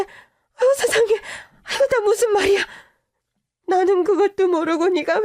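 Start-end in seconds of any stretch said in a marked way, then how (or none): 0.0-2.6 higher, tearful gasps of dismay; crying dismay. Acted. Mother hears her daughter's story, crying in dismay. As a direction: wail it through tears.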